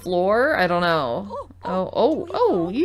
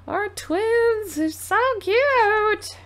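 high, slow delighted coo; gasp, then gush over the cute twins; gasping coo of delight at babies; 13 semitones higher, much slower than usual. Real, not acted. Cooing over the newborn twins.

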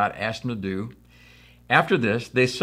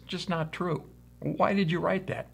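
higher, softer puzzled objection; acted protest in a hushed, raised pitch; mock protest; 7 semitones higher, 8 dB quieter than usual. Preacher questioning his friend about a made-up biography, mock protest.

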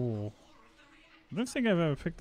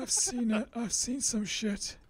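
grim veteran voice; 8 semitones higher, slower than usual. Acted. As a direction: say it like a haunted old soldier. Mock dramatic veteran line.

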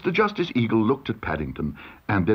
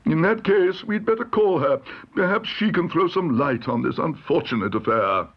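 higher-pitched, exasperated judge; pompous judge; 7 semitones higher than usual. A narrator's reading. Judge decides to call Missus Bird, pompous. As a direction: make a weary judicial pronouncement.

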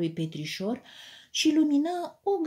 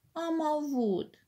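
complaining; 8 semitones higher, 3 dB quieter, slower than usual. A narrator's reading. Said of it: "se plânse".

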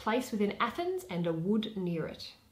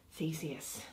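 ordinary delivery, nothing marked

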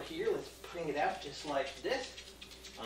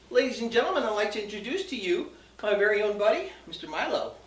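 showman announcer; 5 semitones higher, 6 dB louder than usual. Acted. Stage announcer introduction.

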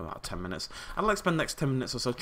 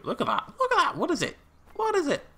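high-pitched excited exclamations; excited squeal; 4 dB louder than usual. Real, not acted. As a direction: squeal it, amazed at the sight. Excited at a sight in game.

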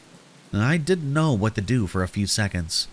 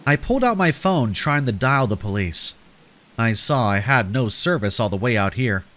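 usual speaking voice

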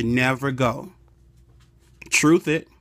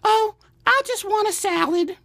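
much higher, dainty put-on voice; wife's voice. Husband imitating his wife at the restaurant, high and dainty. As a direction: sweetly claim you want little. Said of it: "She'll say".

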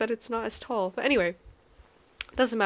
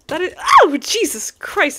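startled yelp; 9 semitones higher, 10 dB louder than usual. Real, not acted. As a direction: jump at the scare. Startled by a mob.

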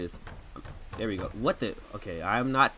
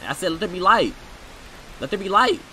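insistent command; 5 semitones higher, 5 dB louder, faster than usual. Acted. Repeating the command louder.

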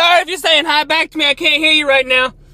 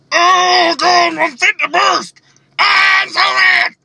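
higher, slow hammed-up rage; mock fury; 7 semitones higher, much slower than usual. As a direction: play the fuming character way over the top. Acting a furious character.

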